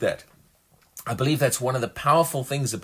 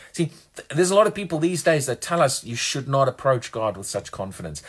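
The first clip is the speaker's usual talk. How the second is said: ordinary talk, nothing marked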